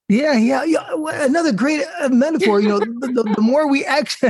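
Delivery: high, bubbling enthusiasm; tripping over words in excitement; excited. Real, not acted. Host delighted by a co-speaker's gym metaphor, excitedly. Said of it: "I got so excited".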